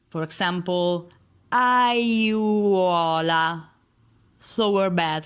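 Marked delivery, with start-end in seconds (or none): 1.5-3.6 aiuola drawn out, a-iu-O-la; four-vowel word. Shown as a demonstration. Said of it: "words with, uh, four vowels".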